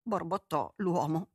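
plain reading voice

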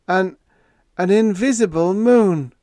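high, loud, stunned stutter; awed stammer. A narrator's reading. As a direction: stammer it out awestruck. Todd stammering the strange fact.

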